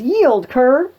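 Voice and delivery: loud, high demand to surrender; commanding challenge. Acted. Pistol orders his French captive to yield, loud and commanding. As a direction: bellow it like a swaggering captor.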